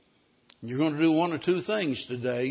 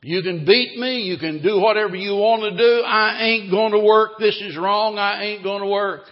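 loud, high defiant shouting; defiant protest; 5 semitones higher, 7 dB louder than usual. Acted. Slave shouting at the auction that he won't work, loud defiance. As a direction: hurl the refusal at the bidders. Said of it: "Joe said".

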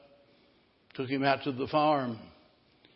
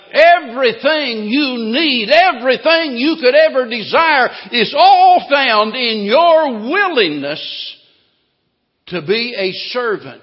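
loud, high fiery preaching; 8 semitones higher, 12 dB louder than usual. Acted. Preacher to congregation, fiery and loud. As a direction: drive the point home at full force.

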